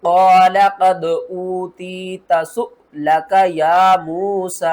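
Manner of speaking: slow, loud tajweed chant; recitation with jerk. Shown as a demonstration. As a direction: recite slowly, catching sharply on the hamza. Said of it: "झटके के साथ पढ़ते". (हमज़ा पर झटके के साथ तिलावत)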